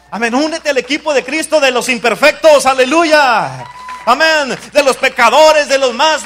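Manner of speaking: loud, high rallying cry from the pulpit; fervent shouted preaching. Real, not acted. Pastor calling sinners onto Christ's team, fervent shouted preaching. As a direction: shout the appeal with full force.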